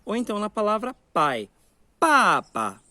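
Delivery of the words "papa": "papa" with a loud falling first "pa" and a light second; fourth tone, then neutral. Shown as a demonstration. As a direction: Mandarin "papa", forceful drop then short weak syllable. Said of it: "palavra de quarta entonação".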